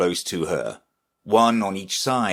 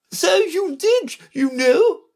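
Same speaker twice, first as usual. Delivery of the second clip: very high, slow, emphatic queen; imperious Red Queen; 5 dB louder, slower than usual. Acted. Red Queen to Alice, high reproof. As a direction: pronounce it high and commanding.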